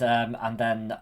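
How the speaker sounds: ordinary talk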